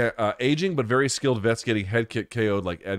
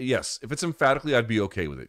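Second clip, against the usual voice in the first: usual speaking voice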